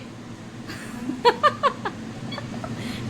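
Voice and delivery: an airy, unvoiced giggle up high; breathy high giggle. Real, not acted. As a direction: giggle under her breath at the joke. Laughing at own sales pitch.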